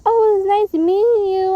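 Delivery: high, loud, sugary farewell; sweet on the surface, just leaving; fake polite.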